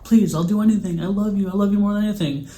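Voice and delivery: high-pitched, urgent mock plea; desperate pleading. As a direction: imitate a boy begging his mom, high and loud. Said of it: "he sounds desperate, he's pleading".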